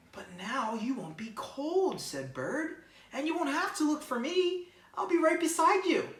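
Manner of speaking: high, slow at first, reassuring bird; comforting bird. Acted. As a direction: promise a worried friend you'll stay close.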